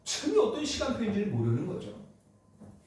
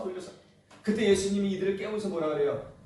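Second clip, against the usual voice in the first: ordinary talk, nothing marked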